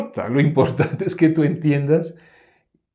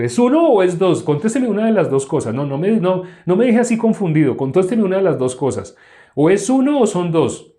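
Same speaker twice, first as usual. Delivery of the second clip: loud, high insistent questioning; mock demanding; 8 semitones higher, 8 dB louder than usual. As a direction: play a baffled mind pressing for an answer. Acting the confused human mind demanding. (exigencia fingida, fuerte y aguda)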